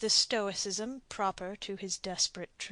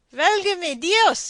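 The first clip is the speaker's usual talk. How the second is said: much higher, much louder, slow anguished cry; 9 semitones higher, 12 dB louder, slower than usual. A narrator's reading. Don cries out to God in anguish. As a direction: cry out like a man in torment. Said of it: "he cried, like a man in anguish".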